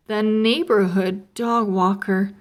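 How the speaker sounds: plain reading voice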